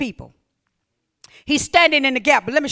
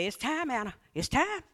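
soft calling voice; 12 dB quieter than usual. Acted. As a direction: murmur it like a summons. God's voice quoted softly.

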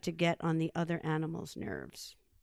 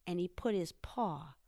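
ordinary delivery, nothing marked

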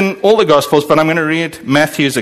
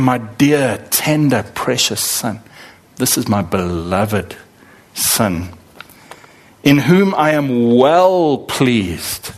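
tender God the Father; 4 semitones lower, slower than usual. Retold or imitated. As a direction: let each word of love sink in. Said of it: "God is saying".

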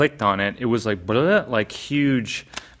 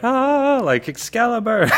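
much higher, louder delighted gasp; excited; 13 semitones higher, 4 dB louder than usual. Retold or imitated. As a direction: exclaim it like finding treasure. Retold delighted discovery.

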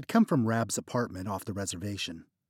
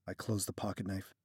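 usual speaking voice

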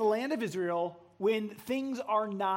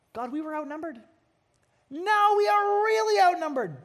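rising high, louder cry; panicked plea; 7 semitones higher, 6 dB louder than usual. Acted. Gideon crying out to God, high panicked voice. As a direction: panic as the odds worsen.

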